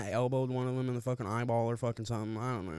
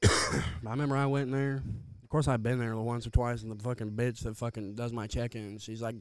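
normal talking voice

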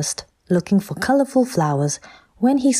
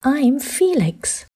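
little Triceratops; 8 semitones higher, slower than usual. Acted. Little Triceratops names himself.

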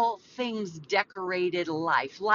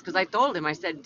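ordinary talk

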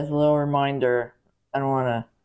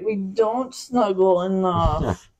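slow; 2 semitones higher, much slower than usual. Retold or imitated. Quoting partner, drawn out.

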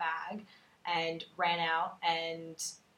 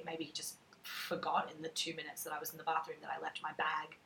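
whispering; 4 semitones lower, 10 dB quieter than usual. Retold or imitated. Inner thought whispered.

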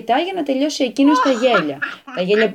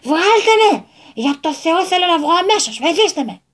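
trapped panicked shout; 5 semitones higher than usual. A narrator's reading. Old man trapped in a jar, yelling to get out. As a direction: yell to be let out, frantic. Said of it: "σαν εξαγριωμένος γάτος".

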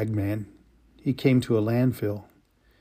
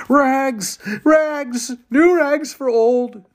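high, loud, drawn-out street vendor's call; a ragman hawking his wares, calling loud and high up the street; street cry; 14 semitones higher, 5 dB louder, much slower than usual. Acted. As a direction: cry it out down the alley like a rag peddler.

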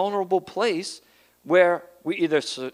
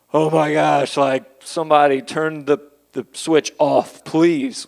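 imitating pain; 7 dB louder than usual. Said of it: "you like".

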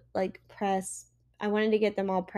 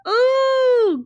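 high, loud squealed ooh; real thrilled squeal; shook; 11 dB louder than usual. Real, not acted. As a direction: squeal with excitement. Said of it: "I'm shook".

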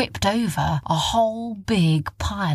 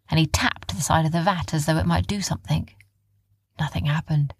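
ordinary delivery

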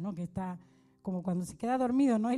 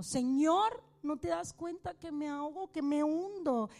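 desperate cry; 6 semitones higher than usual. Retold or imitated. Drowning disciple crying out to the Lord, desperate higher cry. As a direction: beg as if drowning. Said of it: "con un grito desesperado".

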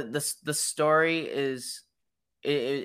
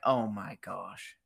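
sad; 5 semitones lower, 6 dB quieter, slower than usual. Retold or imitated. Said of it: "I was just so sad".

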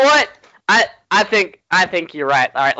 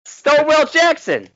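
excited call; 4 dB louder than usual. Pretend. Calling out to the ghost.

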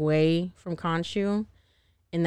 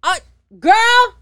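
excited shout; 11 semitones higher, 10 dB louder than usual. Real, not acted. Teasing her cohost, a loud high delighted shout. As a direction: belt it out way up high.

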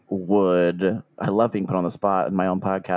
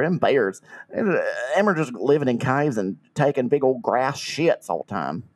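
put-on Southern accent. Customer complains to the shop about missing tigers, in a Southern accent. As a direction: lay the Southern accent on thick, like a trailer-park customer. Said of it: "That was an amazing Southern accent".

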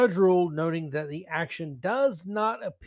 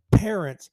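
PARENTS hit loud, standing alone; emphatic; 6 dB louder than usual. Real, not acted. Host reminding negligent parents of their role, emphatic. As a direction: punch PARENTS as a single word. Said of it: "I emphasize that word".

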